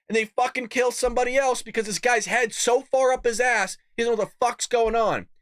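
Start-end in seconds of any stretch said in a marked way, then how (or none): none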